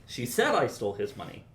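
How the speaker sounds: "said" punched up; stress on SAID. Shown as a demonstration. Teacher shows stress to the composer, landing on "said". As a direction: hit the word "said". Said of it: "depending on how you emphasize".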